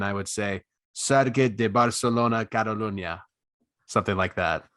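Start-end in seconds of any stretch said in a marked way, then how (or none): 0.9-3.2 put-on Spanish accent with tapped r; Spanish man. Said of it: "talk like a Spanish man".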